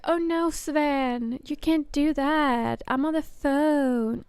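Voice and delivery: high, slow, giggly protest; flustered caller. Retold or imitated. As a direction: drag it out like she's being distracted. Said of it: "She was like".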